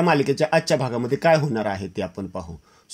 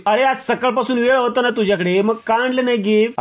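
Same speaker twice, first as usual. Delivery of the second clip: higher-pitched irritated complaint; peeved nagging over the gift; sister's annoyed higher scolding; 7 semitones higher than usual. Acted. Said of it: "अस्मी चिडचिड बोलते".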